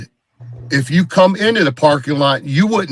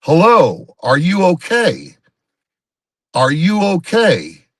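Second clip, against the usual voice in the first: calling out over a loudspeaker, a bit higher, slow; 4 semitones higher, slower than usual. Retold or imitated. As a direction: imitate an officer hailing through a speaker. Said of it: "he gets on the loudspeaker and he goes".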